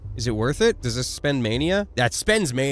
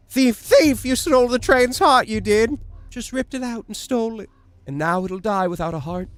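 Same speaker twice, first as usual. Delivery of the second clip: voiced child; 8 semitones higher than usual. Voicing the kid in game.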